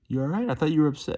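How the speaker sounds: high, lilting imitated concern; concerned check-in. Retelling the guy at Moe's asking after her, high-voiced concern. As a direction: lift the voice high as if checking on someone.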